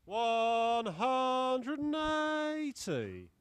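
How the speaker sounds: long, loud, stretched-out "One hundred and eighty"; drawn-out 180 call. Acted. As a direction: belt out the maximum like a darts caller. Darts caller calling a maximum.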